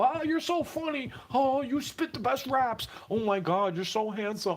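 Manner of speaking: high, gushing fan voice mocking admirers; mock fawning praise. Imitating fans who fawn over him, gushing and high. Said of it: "laugh and point and go".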